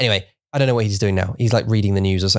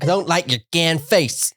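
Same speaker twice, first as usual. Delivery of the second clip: slow, higher, laughing Missouri swearing; Missouri accent; 6 semitones higher, slower than usual. Retold or imitated. Imitating Ruth swearing for a friend, in a laughing Missouri accent. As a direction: ham up the Ozark woman's swearing. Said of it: "I'm saying it in that accent".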